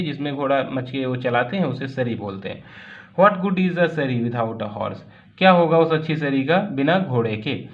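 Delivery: usual speaking voice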